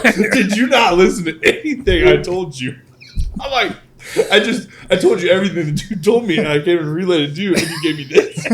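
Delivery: incredulous, laughing, pitched high; mock outrage through giggles; exasperated laughing. New salesman retelling his disbelief, laughing.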